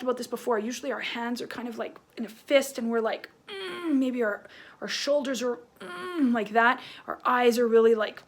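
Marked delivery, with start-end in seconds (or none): 3.5-4.0 short, higher angry "mm"; mock an angry, tensed-up hum; angry grunt. Shown as a demonstration. Teacher showing kids clenched fists, angry hum. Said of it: "if we're kind of angry". 5.8-6.3 short, higher angry "mm"; mock an angry, tensed-up hum; angry grunt. Shown as a demonstration. Teacher showing kids clenched fists, angry hum. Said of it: "if we're kind of angry".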